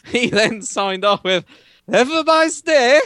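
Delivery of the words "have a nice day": higher, hammed-up accented farewell; the German driver signing off in a put-on accent; mock American-German accent. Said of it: "he's an American German".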